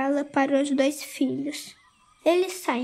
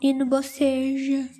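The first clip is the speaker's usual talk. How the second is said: ordinary delivery, nothing marked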